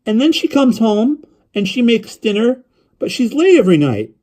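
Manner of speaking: put-on high boy's voice, running on eagerly; young boy rambling on the phone; chattering lonely boy. A narrator's reading.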